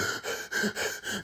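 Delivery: mock shocked gasp. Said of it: "she's like".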